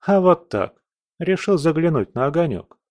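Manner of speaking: high, light character voice; breezy nonchalance. Acted. Commander casually answers stunned spirit, breezy. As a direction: answer as if just dropping by.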